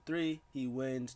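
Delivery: normal talking voice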